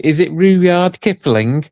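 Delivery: half-voiced quote of Mel sounding out Rudyard Kipling; imitating Mel reading a name. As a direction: mimic a friend puzzling over Rudyard Kipling. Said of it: "and she's saying".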